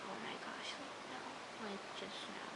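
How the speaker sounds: hushed, almost voiceless disbelief; appalled whisper. Retold or imitated. Vlogger dreading that he'd strangle her next, appalled whisper. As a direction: whisper it as if too stunned to speak. Stunned reaction to imagined strangling.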